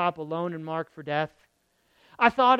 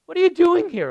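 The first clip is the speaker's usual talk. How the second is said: higher and louder, incredulous; mock divine question; 9 semitones higher, 7 dB louder than usual. Preacher voicing God's question to Elijah, high and loud.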